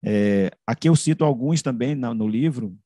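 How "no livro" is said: usual speaking voice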